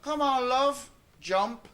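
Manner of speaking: high, loud, coaxing father voice; coaxing dad. Retold or imitated. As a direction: call up to the child on the table, all encouragement. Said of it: "and he says".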